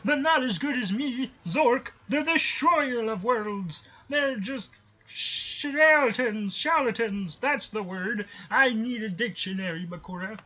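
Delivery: high, slow boastful destroyer voice; villain voice. Pretend. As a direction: gloat like a cartoon god.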